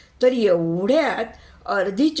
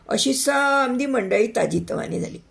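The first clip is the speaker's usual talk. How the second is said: plain reading voice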